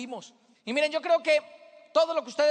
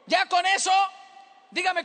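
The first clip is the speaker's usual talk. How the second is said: normal talking voice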